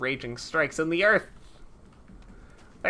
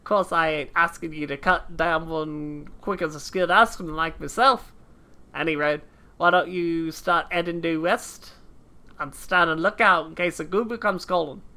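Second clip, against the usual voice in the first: put-on hammy British accent drifting into Australian; muddled British-Australian character accent; struggling put-on accent. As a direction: play a gruff game veteran in a wobbly accent. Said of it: "I'm gonna die doing this accent".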